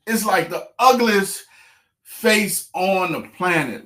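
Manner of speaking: loud, high, breathless telling; excited. Real, not acted. Angler telling viewers about the fish fight. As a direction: relive the big fight loudly.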